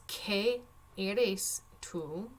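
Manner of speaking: qué eres tú stretched out slowly; slow reading. Shown as a demonstration. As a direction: read the question slowly to students. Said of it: "Número dos".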